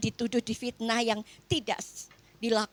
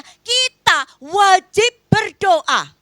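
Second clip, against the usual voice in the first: preaching emphasis; 7 semitones higher, 11 dB louder, much slower than usual. Real, not acted. Preacher drives home duty.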